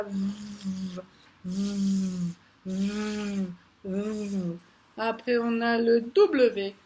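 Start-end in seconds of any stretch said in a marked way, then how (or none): 0.0-4.3 soft buzzing v, quieter; letter sound V. Shown as a demonstration. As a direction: buzz "V" lips on teeth. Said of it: "il fait quoi".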